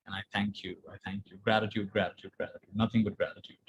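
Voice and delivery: soft fading mantra of thanks; hushed gratitude. Shown as a demonstration. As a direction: let it fade to a near whisper.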